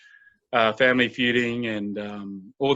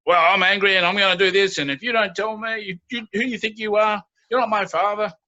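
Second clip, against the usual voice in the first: higher, fast defiant outburst; acted angry youth; 8 semitones higher, 3 dB louder than usual. Angry youth challenges the mentor, acted defiant outburst. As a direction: throw out an angry teenage challenge. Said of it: "you're angry".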